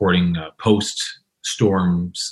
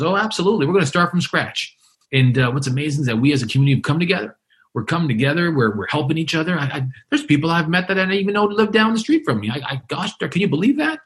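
much higher, eager, running on; all excited; 7 semitones higher, faster than usual. Retold or imitated. Survivor raving to the reporter about neighbors, excited and higher. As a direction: bubble over about the community. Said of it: "He's all excited about what's going on".

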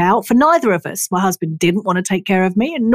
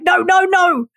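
exasperated refusal; 10 semitones higher, slower than usual. Retold or imitated. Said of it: "And I was like".

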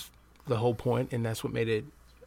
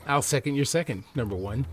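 usual speaking voice

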